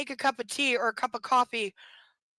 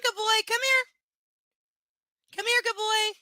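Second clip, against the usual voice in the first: higher, sing-song coaxing; coaxing the dog; 7 semitones higher, 4 dB louder than usual. Real, not acted. Owner coaxes her dog to come, sing-song.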